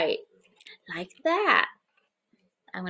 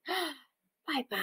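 unvoiced, softer bye-bye; whispered bye-bye; 3 semitones higher, 15 dB quieter than usual. Teacher bids germs goodbye, whispered. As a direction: wave germs off in a hush.

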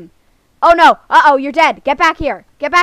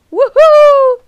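loud, high-pitched woohoo; excited victory whoop; gleeful whoop; 12 semitones higher, 8 dB louder than usual. Real, not acted. Celebrating in the game.

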